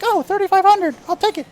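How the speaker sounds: high, squeaky cat-like voice; kitty voice. Retold or imitated. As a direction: squeak it out like a kitten. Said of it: "I'm trying to do a kitty voice".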